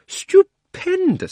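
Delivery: put-on an old schemer who can't believe his luck; thrilled exclaiming. Uncle Andrew thrilled by discovery.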